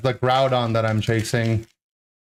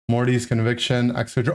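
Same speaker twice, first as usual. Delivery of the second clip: ordinary talk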